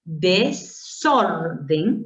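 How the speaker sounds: desorden drawn out as de-sor-den; syllable by syllable. Shown as a demonstration. Teacher showing how desorden divides, syllable by syllable. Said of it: "lo correcto sería de esta forma".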